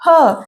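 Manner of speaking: isolated 'ha' for H; phonic H sound. Shown as a demonstration. Teacher answering her drill question, isolated 'ha'. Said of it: "क्या पढ़ेंगे?".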